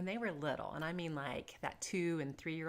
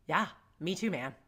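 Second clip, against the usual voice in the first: ordinary talk, nothing marked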